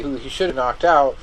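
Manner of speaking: ordinary delivery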